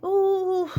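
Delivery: hammed-up pained groan; mock groan. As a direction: groan like a fake bellyache. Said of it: "she groaned".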